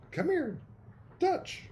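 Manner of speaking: high sing-song call to a dog; calling a puppy. Real, not acted. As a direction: coax the puppy over in a high voice.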